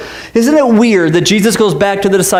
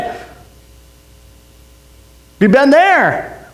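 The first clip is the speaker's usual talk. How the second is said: normal talking voice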